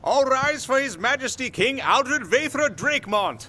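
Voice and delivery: high, ringing herald's call; herald announcement. Acted. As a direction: announce the king to the court.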